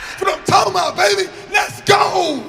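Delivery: high, full-volume victory yell; wild celebration. Acted. Pupil praised by teacher celebrating inside, triumphant shouting. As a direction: cheer like you just won big. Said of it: "Inside, I like this".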